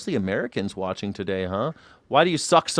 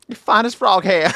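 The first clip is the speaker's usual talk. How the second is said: put-on Southern accent; 8 semitones higher, 6 dB louder than usual. Southern phrase imitated.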